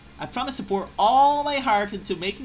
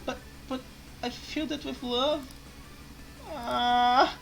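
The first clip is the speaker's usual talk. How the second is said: whimpering high fake cry; 8 semitones higher, slower than usual. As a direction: pretend to be hurt. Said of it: "fake cry act".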